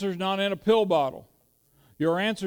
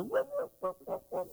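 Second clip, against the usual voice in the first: tiny, high, soft yelps; imitated dog yelp; 8 dB quieter than usual. Preacher mimics the whacked dog running off, high soft yelping. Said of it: "I heard a yelp".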